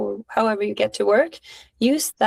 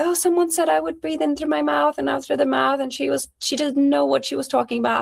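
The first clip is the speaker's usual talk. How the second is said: higher voice, mocking the teacher; complaining student; 5 semitones higher than usual. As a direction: imitate a student griping about a teacher.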